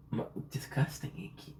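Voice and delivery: whispered disgusted aside. Real, not acted. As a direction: breathe it under your breath. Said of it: "my apologies".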